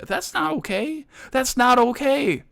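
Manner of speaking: very high-pitched protest; high, exasperated indignation; indignant. Acted. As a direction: object in a squeaky-high, wounded voice. Indignant on the offended cook's behalf.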